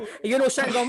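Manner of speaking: normal talking voice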